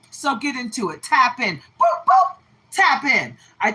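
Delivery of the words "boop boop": squeaky boop. Acted. Playful sound after tap in.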